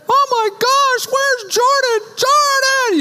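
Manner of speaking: high, slow frantic calling for the lost son; wife calling out frantically for their missing son, very high; panicked wife. Retold or imitated. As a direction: shriek his name in a panic. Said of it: "Wendy's a little bit more Wendy".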